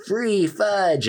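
high-pitched, drawn-out mock yell; retold yell. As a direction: cry out high, without real volume. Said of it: "looked at each other and yelled".

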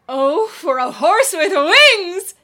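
loud, slow theatrical cry; grand Shakespearean declaiming. Acted. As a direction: declaim it like on stage. Rehearsing Shakespeare monologue.

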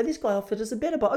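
mock customer, fast and high. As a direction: rattle it off like a bargaining tourist. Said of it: "They go".

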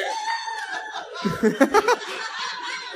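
shrieking high laugh; high burst of laughter. Real, not acted. Reacts to the question.

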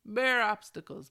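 loud, exasperated emphasis; emphatic outburst. Real, not acted. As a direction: punch it out, fed up. Frustrated about life's obstacles.

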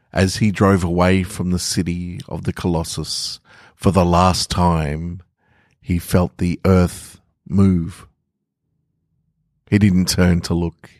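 ordinary delivery, nothing marked

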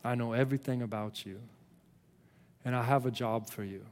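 low, soft and intimate, with long pauses; God speaking to Moses. Acted. God tells Moses he has a job for him, low and hushed. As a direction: speak quietly, letting each statement settle. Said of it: "It's like God is saying".

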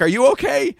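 high, loud and anxious; mock panicked are you okay; anxious high-pitched question. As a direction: ask it in a worried rush, voice jumping up. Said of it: "She's like".

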